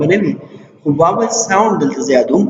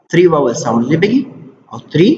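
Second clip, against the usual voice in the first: ordinary talk, nothing marked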